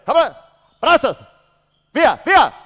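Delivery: high, very loud coaching yells; real courtside shout; urgent shouting. Real, not acted. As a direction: drive the players on in bursts. Coach urges players during drill.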